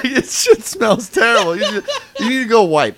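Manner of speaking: mock-order him off to wipe; laughing tease. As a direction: friends ribbing Shady, laughing loudly. Teasing friend about the fart.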